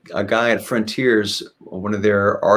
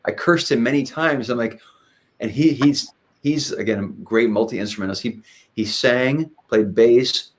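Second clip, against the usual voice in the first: ordinary delivery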